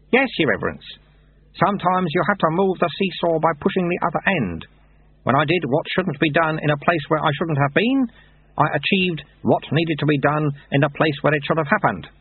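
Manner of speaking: lightly voiced wry old monk; dry, knowing old sweeper; old monk Lutze. A narrator's reading.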